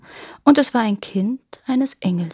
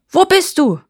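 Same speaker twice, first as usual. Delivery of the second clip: high, loud demanding shout; sharply raised, shouted question; officer's shout; 9 semitones higher, 6 dB louder than usual. A narrator's reading. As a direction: yell it as an angry search. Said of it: "schreit der Offizier".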